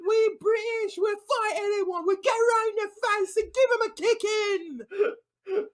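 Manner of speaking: high-pitched mock British hard man; hammed-up scrappy fighter's promo; Vinny Jones. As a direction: put on a combative British voice. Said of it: "Vinny says".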